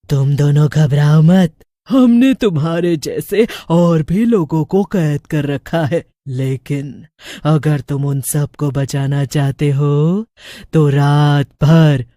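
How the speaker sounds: low, slow menacing witch voice. A narrator's reading. Witch threatening the two captive boys, low and slow. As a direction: threaten them like a gloating witch. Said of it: "चुड़ैल कहती है".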